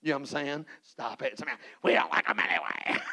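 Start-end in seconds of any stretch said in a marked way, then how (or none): 1.8-3.0 laughing heckle. Acted. Congregant heckle voiced laughing.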